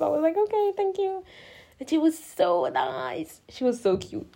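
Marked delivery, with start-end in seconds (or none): 0.4-1.2 small, high voice; meek thanks. Retold or imitated. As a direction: imitate yourself thanking the dentist meekly. Own meek answer to dentist.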